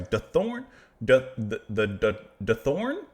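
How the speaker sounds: stumbling over a name, then asking; halting, repeated false starts on a name; stuttering. Real, not acted. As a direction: trip on the first syllable, restart it several times. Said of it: "I'm stuttering on your name".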